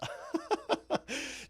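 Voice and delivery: a breathy laugh that jumps high; high airy giggle at the trap; high breathless laugh. Real, not acted. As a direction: laugh it off, caught out. Laughing after near-misread prank.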